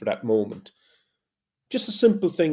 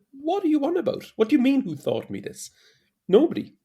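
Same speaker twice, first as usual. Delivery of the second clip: incredulous reply; 12 semitones higher than usual. Retold or imitated. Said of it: "I said".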